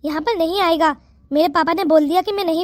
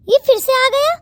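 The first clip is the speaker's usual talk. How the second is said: startled; 6 semitones higher than usual. Acted. Thief comes back.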